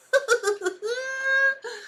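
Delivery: high, squealing laugh that tips into crying; real laughter that gets away from you; helpless laughter. Real, not acted. Host cracking up at a viewer's comment, squealing laugh.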